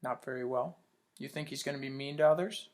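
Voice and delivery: ordinary talk, nothing marked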